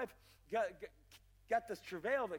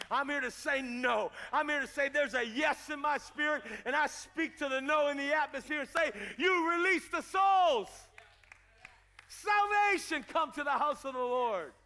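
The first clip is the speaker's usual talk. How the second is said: sermon declaration swelling to a high, loud shout; full-force preaching; 4 semitones higher, 6 dB louder than usual. Acted. Preacher speaking to the no in the atmosphere, shouted proclamation. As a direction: proclaim it forcefully, stretching the final commands.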